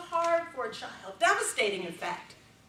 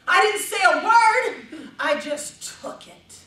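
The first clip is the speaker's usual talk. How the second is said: ordinary delivery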